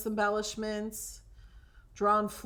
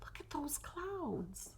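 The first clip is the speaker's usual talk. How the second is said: slow, hushed awed whisper; 5 semitones higher, 12 dB quieter, slower than usual. Real, not acted. Crafter showing viewers the clouds, hushed whisper. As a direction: murmur it like a secret delight.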